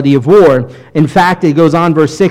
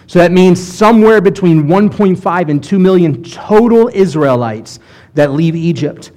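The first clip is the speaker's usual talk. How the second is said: normal talking voice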